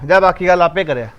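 ordinary talk, nothing marked

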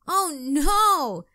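loud, high dismayed groan; a child's groan, rain spoiling the fireworks. A narrator's reading. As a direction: wail it in dismay. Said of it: "Katie groaned".

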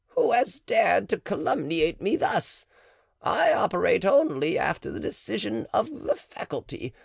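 lightly voiced cautious midwife; the matron. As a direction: answer warily, keeping your distance.

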